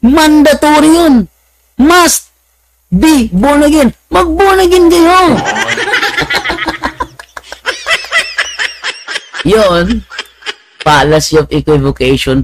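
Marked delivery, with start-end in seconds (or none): 0.0-5.2 mock preacher. Mimicking pastor's urgency. 5.2-9.4 long giggle, pitched up; drawn-out giggle; high giggling fit. Real, not acted. Laughing at own story.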